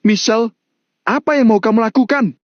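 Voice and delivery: high, alarmed question; alarmed cry. A narrator's reading. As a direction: call out in alarm as she runs off. Mario sees Michelle run to balcony.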